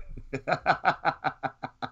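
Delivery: soft, trailing chuckle; amused, quiet laughter; real laugh. Real, not acted. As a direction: laugh softly in a run of short pulses. Laughs after the Linda remark.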